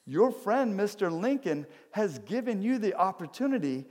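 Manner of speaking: high-pitched incredulous onlooker; storyteller's astonished voice, high and emphatic; acted astonishment. Onlookers astonished by the veteran's paper.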